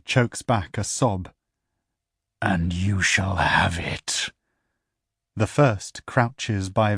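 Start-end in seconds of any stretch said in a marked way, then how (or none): 2.4-4.3 hushed, breathy promise; whispered promise. Acted. The infernal whispering its promise to Duet. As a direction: whisper it like a secret pact.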